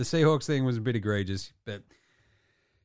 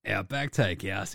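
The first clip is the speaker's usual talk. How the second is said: ordinary talk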